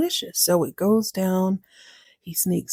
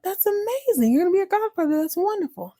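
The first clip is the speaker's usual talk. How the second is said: much higher, gushing congratulations; delighted mouse; 12 semitones higher, faster than usual. Acted. Mouse congratulating cat on being godfather, high and thrilled. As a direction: squeal with delight for a friend.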